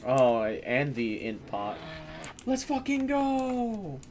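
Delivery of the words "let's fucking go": high, slow, drawn-out victory cry; high, slow celebratory chant; hype cheer. Real, not acted. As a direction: stretch it out in triumph, high. Celebrating a drop.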